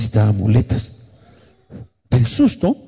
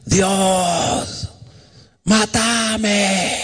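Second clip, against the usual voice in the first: high, long-drawn cry up to God; wailing plea, stretched out high; retold desperate scream; 9 semitones higher, 3 dB quieter, much slower than usual. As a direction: imitate a desperate shout without much volume. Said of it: "ahí empecé a gritarle".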